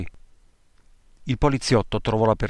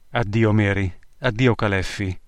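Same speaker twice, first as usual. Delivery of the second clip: usual speaking voice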